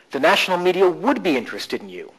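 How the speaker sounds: "would" lifted and leaned on; stress on WOULD. Shown as a demonstration. Coach shifting stress to challenge a belief, stress on "would". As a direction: insist on "would". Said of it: "stress in different places".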